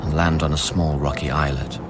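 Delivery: measured inner monologue, hushed and grieving; reflective narration. Acted. First-person narration over music.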